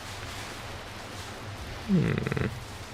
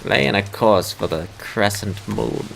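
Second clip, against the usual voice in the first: slow, lowered starship captain voice; measured, low commanding delivery; Kate Mulgrew impression; 4 semitones lower, slower than usual. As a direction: give the order slowly, pitched low. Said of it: "That's my Kate Mulgrew impression".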